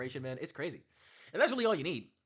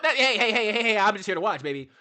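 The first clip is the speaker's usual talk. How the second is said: louder, hey hey hey; mock loud protest; 3 semitones higher, 7 dB louder than usual. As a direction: wave them off, half-joking. Character protests being called out.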